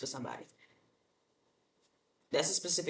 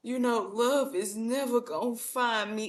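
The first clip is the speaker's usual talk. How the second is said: slow, higher whiny mock lament; imitating a gloomy single complaining; mopey Eeyore; 4 semitones higher, slower than usual. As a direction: moan like nothing will work out. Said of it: "Here you go with the Eeyore syndrome".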